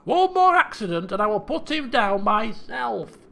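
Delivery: loud, exasperated father; stern king. Acted. King warning his daughter about the bear, stern. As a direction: lay down a loud final warning.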